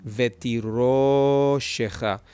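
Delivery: drawn-out RO in "Vetiro shecha"; stress on the RO. Shown as a demonstration. Teacher showing what accent marks do, RO stressed and stretched. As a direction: hold the o of "Vetiro" long, then finish "shecha". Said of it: "accent marks".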